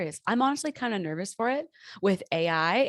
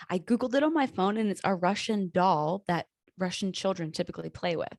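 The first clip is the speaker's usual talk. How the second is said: normal talking voice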